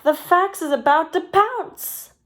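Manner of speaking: high, urgent warning from Pete the chicken. A narrator's reading. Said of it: "Pete warned".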